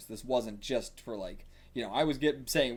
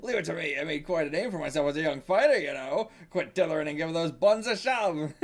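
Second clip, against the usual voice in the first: loud, higher character voice; boastful old hand; 5 semitones higher, 4 dB louder than usual. Acted. Taking over as referee, loud and cocky. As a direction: boast and boss them about loudly.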